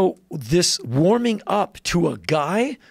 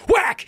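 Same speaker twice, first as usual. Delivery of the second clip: sharp high "whack!" sound effect; mock whack; 4 dB louder than usual. Imitating his dad's backhand slap with a high whack. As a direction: blurt the slap noise up high.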